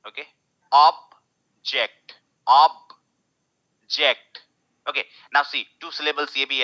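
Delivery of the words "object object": first syllable stressed. Shown as a demonstration. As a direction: object with OB louder. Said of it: "noun है तो first syllable stress होगा".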